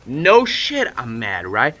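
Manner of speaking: high, loud exasperated comeback; mad retort. Retold or imitated. Boy answers friend asking if he is mad, angry retort. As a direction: snap back loudly, pitch jumping up. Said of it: "and I'm mad".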